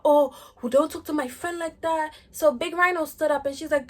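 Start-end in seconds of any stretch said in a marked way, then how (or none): 0.0-2.1 mocking rival. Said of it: "talking about".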